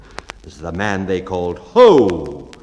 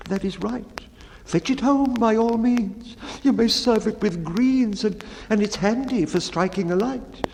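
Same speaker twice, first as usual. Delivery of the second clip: high, meek storyteller voice; the mildest of men pleading to be heard; timid baker; 10 semitones higher than usual. Acted. Baker recounting his sad story to the bellman, high and meek.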